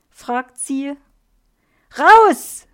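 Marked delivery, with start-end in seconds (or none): 2.0-2.7 loud, high-pitched yell of rejection; furious shout. A narrator's reading. Frank orders Erna out of his room, shouting furiously. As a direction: yell it at full force to drive her out. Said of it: "schreit Frank".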